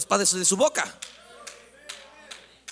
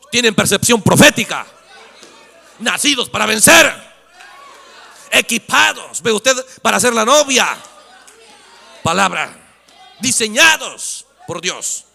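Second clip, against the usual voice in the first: loud, fervent sermon proclamation; full-force preaching; 4 semitones higher, 11 dB louder, slower than usual. Real, not acted. Preacher thunders about the believers. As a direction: proclaim it to the congregation.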